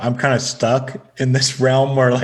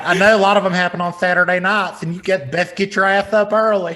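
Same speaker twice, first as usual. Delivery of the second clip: Southern aunt; 5 semitones higher, faster than usual. Acted. Still voicing the Southern aunt.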